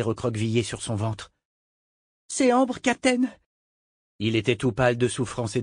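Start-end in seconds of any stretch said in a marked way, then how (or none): 2.3-3.3 garçon, voix bien plus aiguë; voix d'enfant. A narrator's reading. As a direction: gamin apeuré qui interpelle le capitaine. Cliff the boy speaks. (Cliff, voix aiguë d'enfant apeuré)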